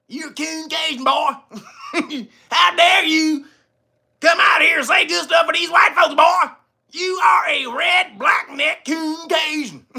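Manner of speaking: high-pitched, hammed-up scolding; mock outrage. Host playing his accuser scolding him, theatrical mock outrage. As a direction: draw out the insult in a high, indignant voice.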